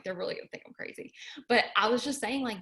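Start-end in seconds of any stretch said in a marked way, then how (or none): 0.0-1.1 soft unvoiced mumble; mumbling under breath. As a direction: mutter it half to yourself, barely audible. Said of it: "mumble under my breath".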